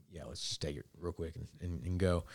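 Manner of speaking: low, hushed agreement; quiet reply. Retold or imitated. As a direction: agree under your breath, keeping it discreet. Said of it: "I said it very quietly".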